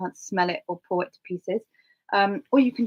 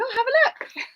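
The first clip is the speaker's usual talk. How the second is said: cheery offer. Retold or imitated. Offering the mat, retold.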